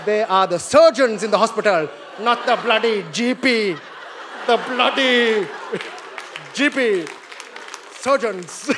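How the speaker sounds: put-on Indian accent. Indian speaker boasting about surgeons in an Indian accent.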